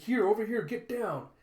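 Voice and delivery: high, loud battle call; urgent calling. Acted. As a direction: call them over urgently.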